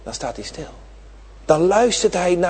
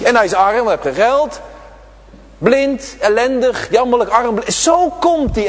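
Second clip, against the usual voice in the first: raised, ringing sermon voice; fervent preaching; 5 semitones higher, 3 dB louder than usual. Acted. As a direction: preach it out to the children, high and carried. Preaching Bartimaeus's state.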